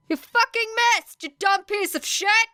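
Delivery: high, loud enraged scream; furious shout. A narrator's reading. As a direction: spit the insult out in fury. Villain furious at missed attack.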